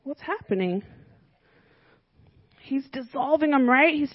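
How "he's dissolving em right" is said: ordinary talk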